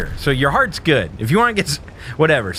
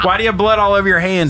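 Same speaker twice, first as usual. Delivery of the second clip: higher, louder, fast questioning cop; mock cop interrogation; 8 semitones higher, 4 dB louder, faster than usual. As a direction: fire the question like a suspicious cop. Cop questioning him, retold.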